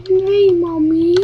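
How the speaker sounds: put-on high, slow little-kid answer; a small child timidly saying no; meek child. Child answering mother in skit.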